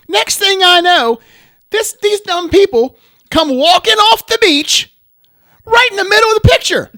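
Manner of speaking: loud, high, outraged retelling; indignant outburst. Retold or imitated. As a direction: still furious about it, let it rip. Retelling beach people ruining photos.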